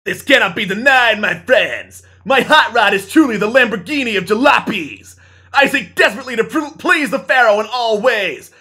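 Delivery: loud, high proud declaration; grandiose hero voice. Acted. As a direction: proclaim it like a hero. Zuckerberg speech in play.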